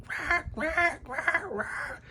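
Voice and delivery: very high imitation squawks; mock bird screech. Imitating her birds yelling at six in the morning, screechy chirps. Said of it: "yelling, screaming, chirps, like".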